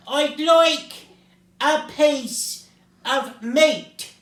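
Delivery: slow, loud roar broken by pauses. A narrator's reading. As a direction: roar it out piece by piece. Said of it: "roared Mister Noisy".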